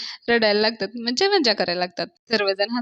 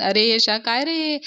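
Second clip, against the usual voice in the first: usual speaking voice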